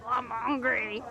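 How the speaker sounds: playful put-on shark voice; hungry shark. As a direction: speak as the shark, in jest. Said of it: "He's like".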